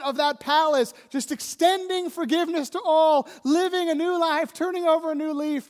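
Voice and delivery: preaching. Acted. Preacher to congregation, building with rising fervor. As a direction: lift the congregation toward hope.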